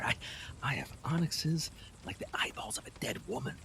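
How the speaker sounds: low, soft, seductive listing; hushed tempting. Acted. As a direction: lure her with low murmurs.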